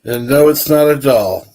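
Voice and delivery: loud, firm insistence; emphatic. Real, not acted. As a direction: shut the argument down loudly. Insists it's an action figure.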